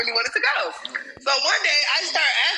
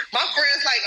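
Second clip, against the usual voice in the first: normal talking voice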